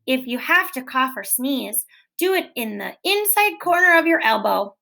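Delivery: usual speaking voice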